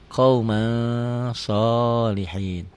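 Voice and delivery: "Qauma" held on ng before a drawn-out "sholihin"; held ikhfa ng. Shown as a demonstration. Teacher adds G to N, "Qauma" with held ng. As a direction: slowly hum from "Qauma" into "sholihin". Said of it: "N-nya tambah G jadi eng".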